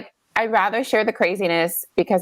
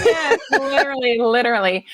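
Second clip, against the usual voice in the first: laughing agreement; 5 semitones higher than usual. Real, not acted. Laughing along with her friend.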